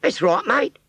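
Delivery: put-on high Cockney girl's voice; rag trade Cockney. Scarecrow model answering Wurzel in high Cockney. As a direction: pert Cockney shopgirl reply. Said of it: "in pure rag trade Cockney".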